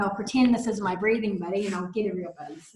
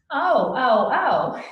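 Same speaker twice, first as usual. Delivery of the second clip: mock pained oh; 2 semitones higher, 6 dB louder, much slower than usual. Shown as a demonstration. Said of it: "take a deep breath into the hurt".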